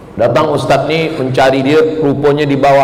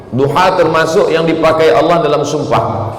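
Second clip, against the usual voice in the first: ordinary delivery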